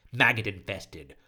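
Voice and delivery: usual speaking voice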